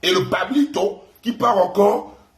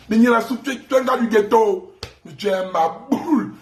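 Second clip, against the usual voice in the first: normal talking voice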